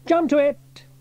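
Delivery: sharp high-pitched command; cried order. A narrator's reading. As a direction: cry out an urgent order to the crew. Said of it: "he cried".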